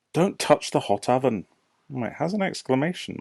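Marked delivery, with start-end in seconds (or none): 0.1-1.5 warning voice. Shown as a demonstration. Reads warning command.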